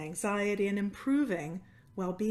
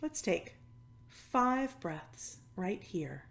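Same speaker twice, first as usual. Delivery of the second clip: guided meditation; 4 dB quieter than usual. Acted. Yoga teacher settling a cancer-support class into stillness, guided meditation. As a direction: ease them into noticing, phrase by phrase, softening at the ends.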